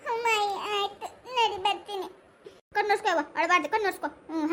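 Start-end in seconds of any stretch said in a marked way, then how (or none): 0.1-2.1 put-on high child's voice agreeing; a small girl saying she'll come along; little girl. (ಪುಟ್ಟ ಹುಡುಗಿಯ ಎತ್ತರದ ಧ್ವನಿ)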